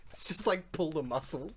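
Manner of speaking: ordinary talk, nothing marked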